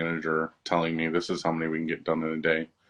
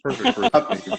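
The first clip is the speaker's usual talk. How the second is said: laughing; 6 dB louder than usual. Real, not acted. Laughing at the pub answer.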